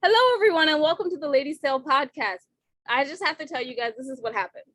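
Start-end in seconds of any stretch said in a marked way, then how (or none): 0.0-2.4 podcast intro. Acted. Podcast opening greeting.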